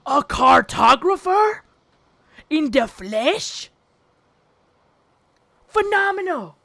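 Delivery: slow, loud, theatrical amazement; mock awe. Streamer marvelling at a chatter drawing her own map, hammed-up awe. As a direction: ham up being amazed.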